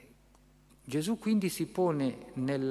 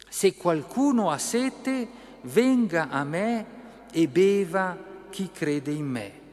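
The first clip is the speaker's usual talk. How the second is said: slow, raised, loud proclamation; proclaimed cry; 4 semitones higher, 5 dB louder than usual. A narrator's reading. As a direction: cry it out slowly to the crowd. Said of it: "gridò". (grido solenne, lento e sonoro)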